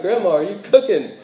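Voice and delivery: eager question. Retold or imitated. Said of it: "I'm like".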